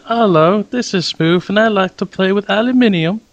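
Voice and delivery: playful fake British accent. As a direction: put on a posh British voice. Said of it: "You should do that accent more often".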